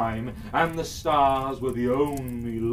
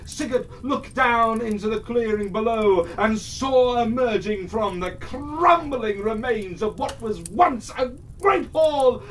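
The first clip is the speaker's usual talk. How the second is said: high and loud, gripping storytelling; dramatic loud narration; 11 semitones higher, 6 dB louder than usual. A narrator's reading. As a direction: burst out as the roar shakes the rock. Roar of the dragon erupts.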